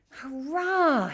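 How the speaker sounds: high, loud cheer; excited shout. A narrator's reading. Boy spotting a shape, high loud cheer. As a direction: celebrate at the top of your voice. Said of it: "shouted George".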